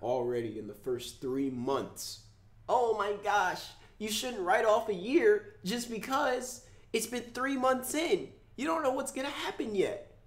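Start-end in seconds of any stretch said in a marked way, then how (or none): 2.7-10.2 high, sing-song voice of a know-it-all; mock high advice voice. Mocking viewers who say a year is too early to judge. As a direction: mimic the naysayer in a high put-on voice.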